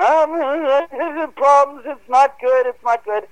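high, wailing imitation of someone cracking under panic; imitated cracking voice. Investigator panicking over the mic, imitated in a high cracky voice. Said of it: "his voice starts getting cracky".

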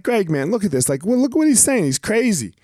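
imitating his younger self, pitch climbing as he protests; worked-up complaint. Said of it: "I was like".

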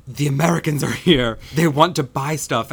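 a touch of mock panic; terrified intern. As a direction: sound alarmed the Americans arrived. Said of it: "is terrified".

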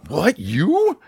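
high, startled what you; a son hearing his mom confess; shocked disbelief. Retold or imitated. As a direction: gasp it out in disbelief.

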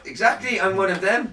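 high camp delivery. Pretend. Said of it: "that was so camp".

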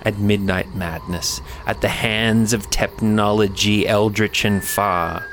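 slow, dramatic ghost story narrator; horror host. Acted. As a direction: weave the tale like a campfire horror host.

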